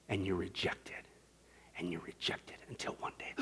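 low, near-whispered reproach; very soft, ominous preaching; hushed warning. Acted. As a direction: let the accusation sink to a grave whisper. Solemn warning about dying.

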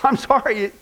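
ordinary delivery

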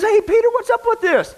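high, whiny confused protest. Retold or imitated. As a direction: play a baffled man demanding answers at the gate. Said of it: "Kenny's all confused".

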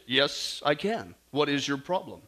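low, composed heavenly voice; reply evenly and low, untroubled; calm voice. Acted. Voice from above answers the desperate tourist, calm. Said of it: "the calm voice says".

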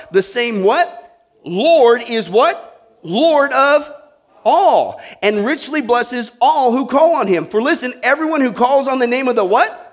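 loud, high preacher calling out the key word; call-and-response preaching. Acted. Preacher drawing the answer from the congregation, loud call. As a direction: throw the question out, land LORD hard.

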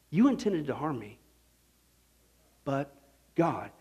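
soft emphatic quote. Shown as a demonstration. Preacher softly landing the key words to the congregation. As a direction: let "but" hang, then land "God" softly. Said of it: "Just those two words".

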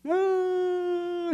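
long high loud wail; hammed-up crying howl; mock wail. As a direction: wail like someone bursting into tears. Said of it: "she started crying".

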